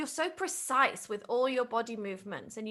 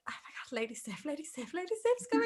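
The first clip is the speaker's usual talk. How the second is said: retold hushed excitement; 3 semitones higher, 5 dB quieter, much faster than usual. Cast member reading that Lady Sif is coming, hushed excitement. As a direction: imitate giddy whispering over big news. Retelling cast's thrill at the script.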